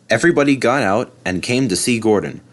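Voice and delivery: usual speaking voice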